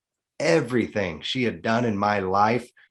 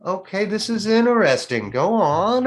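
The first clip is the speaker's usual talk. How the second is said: intrigued calm; 9 semitones higher, 3 dB louder than usual. Retold or imitated. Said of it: "I was just like".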